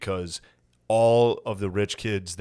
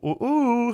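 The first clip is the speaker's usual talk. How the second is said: mock whoops; 13 semitones higher than usual. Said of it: "you'll go".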